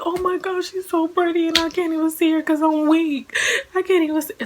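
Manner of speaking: put-on a new mother overcome at seeing her baby; overwhelmed squeal. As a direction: let it spill out, giddy and weak.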